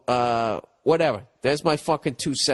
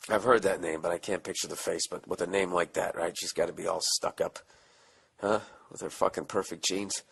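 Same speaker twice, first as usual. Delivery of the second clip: quiet aside; 7 semitones lower, 7 dB quieter than usual. Real, not acted. Aside while reading letter.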